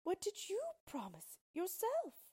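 high-pitched sorrowful question; acted sorrowful princess voice, far above narration; sad princess. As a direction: ask it mournfully as a young princess. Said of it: "replied Bellissima sadly".

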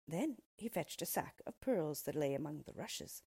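usual speaking voice

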